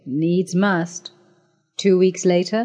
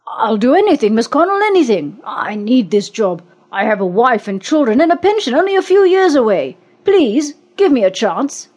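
desperate begging; 5 semitones higher, 4 dB louder than usual. A narrator's reading. Man begs for his job.

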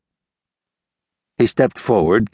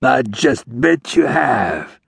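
slow, higher, drawn-out insinuation; suggestive; 5 semitones higher, much slower than usual. A narrator's reading. Dying outlaw teasing the ranger, suggestive. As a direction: leer weakly through the pain. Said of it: "managed in a suggestive tone".

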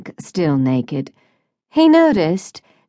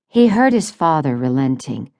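ordinary delivery, nothing marked

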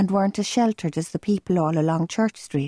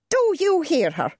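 high, exasperated mother's voice; indignant appeal; 11 semitones higher than usual. A narrator's reading. Mother appeals to her husband, high and exasperated. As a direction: appeal for backup, outraged.

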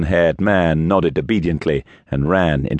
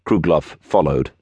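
plain reading voice

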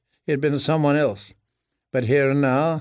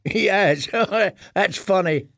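put-on higher boyish voice with a chuckle; amused laughing; 6 semitones higher than usual. A narrator's reading. Boy laughing at the prisoner who eats rats, amused. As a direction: laugh through the words like an amused lad. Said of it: "The first boy laughed".

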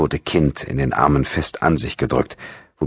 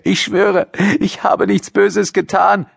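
put-on very high, distressed girl's plea; wailing; slower than usual. A narrator's reading. Daughter swearing innocence to her father, high pitiful wail. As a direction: wail your innocence, drawing it out. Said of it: "jammerte die siebzehnjährige".

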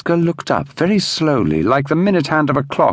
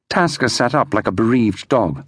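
ordinary delivery, nothing marked

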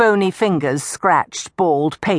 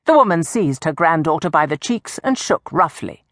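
plain reading voice

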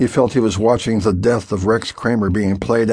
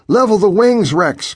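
urgent instruction; 10 semitones higher, 4 dB louder than usual. A narrator's reading. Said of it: "came the voice".

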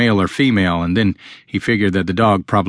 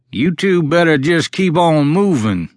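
put-on high, slow raspy old man's call; raspy yelling stranger; 7 semitones higher, slower than usual. A narrator's reading. Old man guarding property from behind trees, raspy yell. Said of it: "a raspy voice yelled from behind the trees".

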